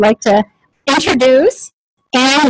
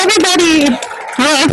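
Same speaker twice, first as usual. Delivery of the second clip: rallying shout; 5 semitones higher than usual. Real, not acted. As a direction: get the room cheering. Rallying audience to cheer.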